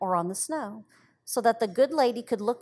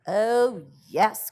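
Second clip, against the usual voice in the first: louder, eager little yes; eager cry; 7 dB louder than usual. A narrator's reading. Peony answering her sister, eager and loud. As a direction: cry it out gladly. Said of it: "cried Peony".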